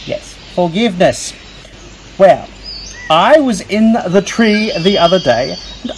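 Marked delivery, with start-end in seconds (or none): none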